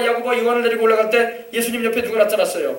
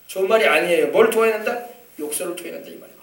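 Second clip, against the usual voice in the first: ordinary talk, nothing marked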